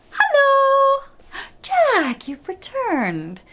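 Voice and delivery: high, loud cheery call; giantess greeting. A narrator's reading. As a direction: sing out a hello to Jack.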